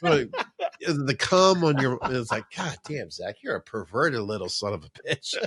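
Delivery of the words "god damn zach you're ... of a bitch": groggy mock scolding. Groggy complaint at night.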